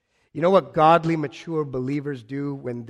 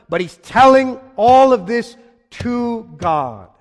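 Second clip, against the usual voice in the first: louder, higher, slowed preaching; rising emphasis; 8 semitones higher, 11 dB louder, slower than usual. Real, not acted. Preacher building to the climax of his point. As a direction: press it home with force.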